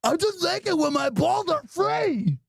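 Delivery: high, slow put-on dim voice; goofy dumb voice. Son proudly explains his missing clothes, slow and goofy. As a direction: drag it out like a proud idiot.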